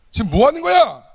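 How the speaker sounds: Jesus rebuking his disciples, angry and loud; angry rebuke. Retold or imitated. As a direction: scold the disciples hotly. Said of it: "노하신 거예요".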